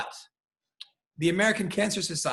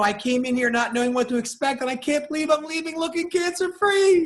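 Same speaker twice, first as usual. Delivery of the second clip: rising from bright to an excited, much higher near-shout; joyful patient testimonial; 9 semitones higher, 3 dB louder than usual. Retold or imitated. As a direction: gush like a woman thrilled with her new wig. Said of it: "they do a thirty second of".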